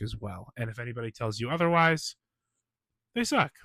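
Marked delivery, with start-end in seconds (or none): 3.1-3.5 sharp, loud, high put-down; blunt jab. Real, not acted. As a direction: spit it out with sass. Defending cast members, blunt jab.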